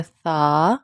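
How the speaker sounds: سا with a soft, lisped th, drawn out; long soft th in سا; soft letter sound. Shown as a demonstration. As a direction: say سا softly as "thaa", no whistle. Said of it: "softly پروناؤنس کیا جاتا ہے".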